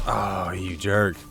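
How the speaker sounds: ordinary delivery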